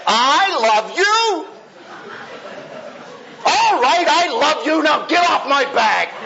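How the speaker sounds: yelling I love you to get rid of her; irritated shout. Shown as a demonstration. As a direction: bark I love you like an annoyance. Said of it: "Well, how do I say it?".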